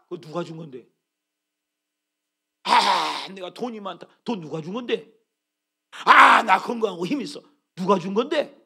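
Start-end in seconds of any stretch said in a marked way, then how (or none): 6.0-7.4 much louder boastful shout; imitating an arrogant man shouting; proud shout. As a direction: crow about your strength. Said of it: "얼마나 교만합니까".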